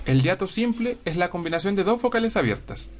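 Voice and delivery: ordinary delivery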